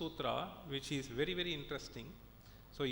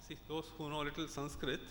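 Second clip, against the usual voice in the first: normal talking voice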